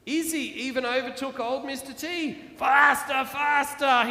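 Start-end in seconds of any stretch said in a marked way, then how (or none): 2.6-4.1 loud, high roar. Acted. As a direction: roar it while racing ahead. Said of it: "he roared".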